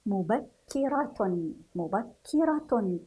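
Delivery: مبكرة slowly with a doubled k; shadda on kaf. Shown as a demonstration. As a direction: lean on the kk in مبكرة, sounding the final tun. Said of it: "سنلاحظ وجود الشدة". (مبكرة ببطء مع تشديد الكاف)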